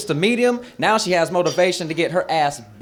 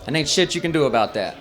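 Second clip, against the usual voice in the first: ordinary talk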